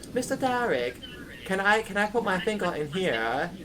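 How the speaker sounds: put-on high, innocent kid voice; little boy's voice. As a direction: ask like a curious little boy. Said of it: "he goes".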